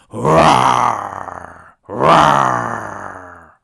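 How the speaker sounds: loud drawn-out roar; wolf roar. Acted. Wolf leaping at the girl, loud roars. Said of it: "said the wolf as he sprang".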